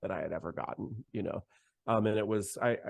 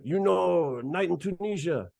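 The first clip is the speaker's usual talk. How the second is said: higher, lively voice of the bandleader on the phone; imitating Arturo; 8 semitones higher, 4 dB louder than usual. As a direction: lightly play the excited bandleader. Arturo quizzing songs.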